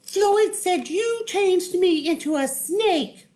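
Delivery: squeaky tearful tale; Wanda. Acted. Wanda tells Wizard her woes, very high. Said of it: "Wanda said".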